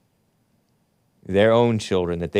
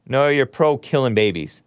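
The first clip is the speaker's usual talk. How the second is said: ordinary delivery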